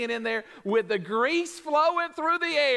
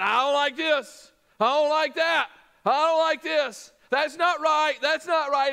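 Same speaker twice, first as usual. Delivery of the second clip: whiny, higher complaining voice; mock griping; 5 semitones higher than usual. A preacher imitating a grumbling worker walking into the job. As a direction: whine like a grumpy worker. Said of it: "Instead of you going in".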